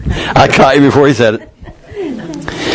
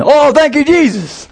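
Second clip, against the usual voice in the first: relieved thanks; 10 semitones higher than usual. Pretend. Imagined grateful escapee.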